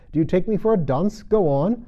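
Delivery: sharp. Acted. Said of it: "said the king sharply".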